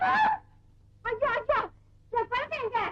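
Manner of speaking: put-on high, loud cry of alarm for the lost child; panicked wail. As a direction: gasp and cry out for the baby. Mother panics, child missing. (குழந்தையைத் தேடும் பதற்றமான அலறல்)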